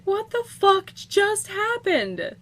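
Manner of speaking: high, slow, stunned question; baffled disbelief. Real, not acted. Gamer stunned by the unexpected win, baffled. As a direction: draw it out high in confusion.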